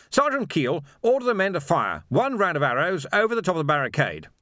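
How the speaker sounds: high, loud officer's barked order; commanding captain. A narrator's reading. Captain orders the sergeant to fire over the barricade, loud and commanding. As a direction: command the squad crisply.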